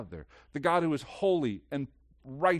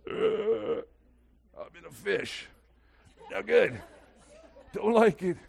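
groaning "ugh", then short recoiling phrases; hammed-up revulsion; mock disgust; 6 semitones higher than usual. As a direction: play squeamish disgust, with pauses between reactions. Voices disgust inside the fish.

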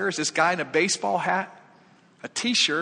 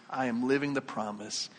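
softer, settled repeat; quiet affirmation; 3 semitones lower, 5 dB quieter than usual. Acted. Believer repeats the declaration, quietly assured. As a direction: let the conviction settle, voice dropping.

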